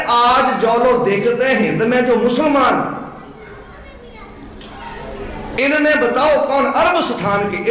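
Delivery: measured, drawn-out verse; slow poem recitation. Retold or imitated. Preacher reciting the poet's verse to the sangat, slow and measured. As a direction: recite the poet's lines slowly. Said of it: "ਕਹਿੰਦੇ".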